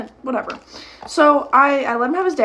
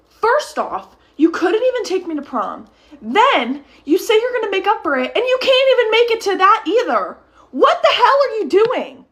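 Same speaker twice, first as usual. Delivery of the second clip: high, indignant tirade building louder; going off on him; 8 semitones higher, 4 dB louder than usual. Retold or imitated. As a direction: tear into him point by point. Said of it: "the way that I went off on him".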